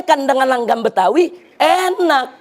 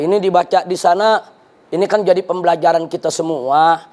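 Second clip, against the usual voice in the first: lightly voiced official, lower pitch; ministry apologist; 6 semitones lower than usual. Preacher quotes ministry official excusing the reciters, lightly acted low voice. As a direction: imitate an excuse-maker in a lowered, resigned voice. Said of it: "ada lagi yang mengatakan begini dari kementerian".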